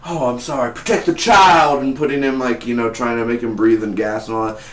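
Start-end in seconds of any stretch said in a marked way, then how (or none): 0.1-1.8 melodramatic plea. Retold or imitated. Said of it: "it's not like".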